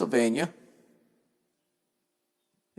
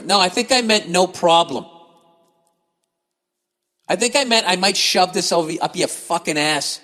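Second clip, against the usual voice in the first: imagined comeback; 4 semitones higher than usual. Pretend.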